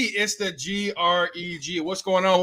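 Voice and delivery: ordinary talk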